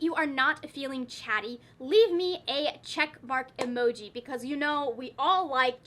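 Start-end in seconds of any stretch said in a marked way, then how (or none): none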